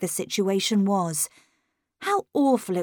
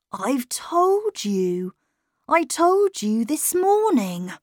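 put-on very slow, higher weary boy; a tired child turning away; 5 semitones higher, slower than usual. A narrator's reading. Boy turns to the wall, slow and weary.

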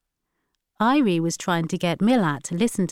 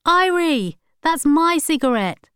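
much higher, loud angry call; shout; 10 semitones higher, 6 dB louder than usual. A narrator's reading. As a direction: yell across at her to stop. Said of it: "she heard Milat shout".

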